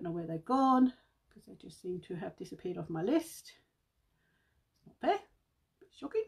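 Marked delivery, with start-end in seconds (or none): 4.8-6.2 high-pitched mock indignation; mock outrage. Vlogger mock-sulking at her missing list, high and squeaky. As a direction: squeak it like a hard-done-by child.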